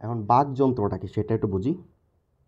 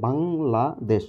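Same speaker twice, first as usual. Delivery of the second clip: "Bangladesh" in three slow beats, বাং, লা, দেশ; teacher sounding out "Bangladesh" as বাং — লা — দেশ; split into syllables; 3 semitones higher, much slower than usual. Shown as a demonstration. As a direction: pause after each syllable of "Bangladesh". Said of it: "তিনটা অক্ষর আছে".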